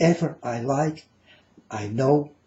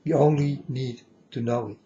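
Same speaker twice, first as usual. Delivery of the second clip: normal talking voice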